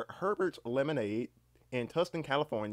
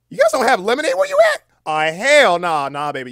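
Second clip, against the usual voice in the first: loud disbelief; 9 semitones higher, 15 dB louder than usual. Real, not acted. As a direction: shout it in scandalized disbelief. Shocked that chatter has no lemonade.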